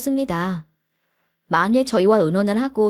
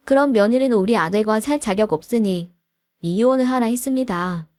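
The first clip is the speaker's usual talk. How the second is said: ordinary delivery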